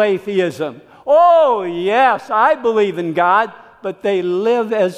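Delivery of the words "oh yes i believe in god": higher, louder breezy "Oh, yes"; hammed-up hollow profession of faith; mock believer. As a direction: ham up a glib churchgoer's claim. Mocking a practical atheist.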